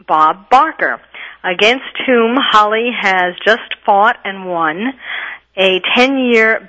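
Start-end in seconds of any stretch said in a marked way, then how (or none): none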